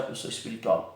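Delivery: mock pious tone. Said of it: "fazer uma entonação na minha voz".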